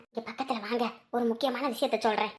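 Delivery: hushed, low-key aside; soft and secretive; confiding. Acted. Drawing listener close to confide.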